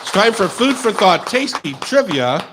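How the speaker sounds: loud, bright segment intro; announcer voice. Acted. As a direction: announce it like a radio host.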